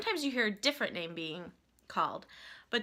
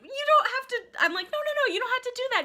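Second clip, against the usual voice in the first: high-pitched, louder mock protest; retold flustered protest; 14 semitones higher, 6 dB louder than usual. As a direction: act out politely refusing, voice raised high.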